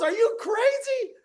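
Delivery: high, shouting disbelief; the king yells at his freed advisor in disbelief; outraged king. Retold or imitated. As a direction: scream it at the advisor. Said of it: "the king goes".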